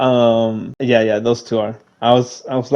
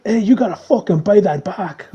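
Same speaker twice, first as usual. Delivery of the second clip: higher-pitched mock Russian accent; 7 semitones higher than usual. Guy demanding payment for the broken remote, mock Russian accent. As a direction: imitate an indignant Russian, pitched up. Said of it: "with this, like, Russian accent".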